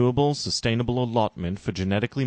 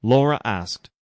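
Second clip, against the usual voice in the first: usual speaking voice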